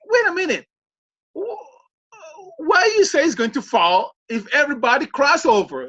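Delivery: high-pitched, incredulous retold challenge; baffled objection. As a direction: act out stopping someone in disbelief. Retells his own baffled objection. (objeción incrédula imitada)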